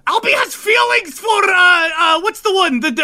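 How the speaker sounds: high, loud outburst, stammering for the word; shouting at the top of his lungs. Acted. Ivan blurting a secret to the whole group, shouting loud. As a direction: bellow it out for everyone to hear. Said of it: "He shouts at the top of his lungs".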